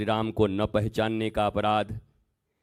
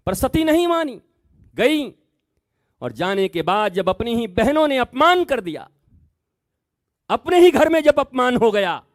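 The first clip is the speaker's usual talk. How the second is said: high, loud impassioned sermon; preaching at full force; 12 semitones higher, 8 dB louder than usual. Acted. Preacher warning the devotees, high and loud. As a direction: hammer the warning home to the crowd.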